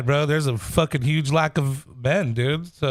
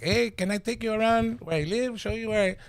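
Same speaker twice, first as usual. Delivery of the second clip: higher-pitched, softer imitation of a stranger; imitating a local guy; 3 semitones higher, 6 dB quieter than usual. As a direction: put on the guy's way of talking. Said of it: "he talked like this".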